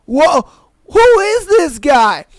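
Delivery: loud, high, drawn-out mock outrage; acted indignant disbelief. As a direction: hit it like a stunned double take. Acts out his baffled reaction.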